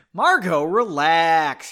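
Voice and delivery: loud, drawn-out plea to calm down; loud exhortation. A narrator's reading. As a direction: call it out, stretching the last word. Henry tells Margot to relax.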